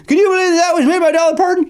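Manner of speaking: very high, mock-astonished voice; amazed. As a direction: imitate an astonished public, squeaking up high. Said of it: "everybody was amazed".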